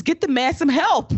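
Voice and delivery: high excited plea; hammed-up Booker call for help; imitating Booker. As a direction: mimic Booker urging help for the ref.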